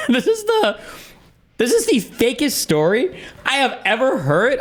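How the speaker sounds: high-pitched, laughing through the words; laughing disbelief. Real, not acted. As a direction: say it while cracking up in disbelief. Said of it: "And I was like".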